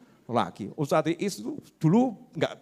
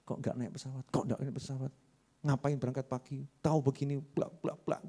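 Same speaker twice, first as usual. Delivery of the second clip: grumbling under breath; 3 semitones lower, 13 dB quieter than usual. Acted. Impatient passenger muttering to himself.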